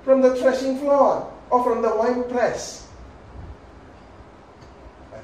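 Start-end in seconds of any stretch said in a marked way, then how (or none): 0.1-2.9 higher, louder mock king; the king declaiming loudly, pitch up; king's reply. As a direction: proclaim it like a pious king. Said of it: "It says what?".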